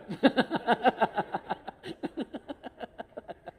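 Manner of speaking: high laugh tailing off; helpless giggles that gradually run down. Real, not acted. Speaker tickled by his own secret, high trailing laughter. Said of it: "kenapa saya ketawa terus".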